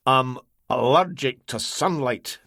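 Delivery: speaking through clenched teeth; clamped teeth. A narrator's reading. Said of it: "said between clamped teeth".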